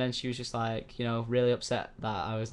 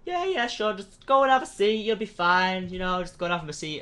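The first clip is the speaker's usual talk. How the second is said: a mocking, much higher and louder brush-off; dismissive receptionist; 7 semitones higher, 6 dB louder than usual. Receptionist fobbing off the dad, mock high brush-off. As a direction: wave them away like a bored receptionist. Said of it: "the mum was like".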